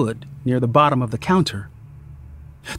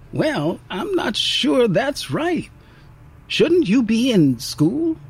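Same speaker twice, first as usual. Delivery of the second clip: lightly voiced waitress, higher and slow; mild motherly concern, unhurried; caring waitress; 7 semitones higher, slower than usual. A narrator's reading.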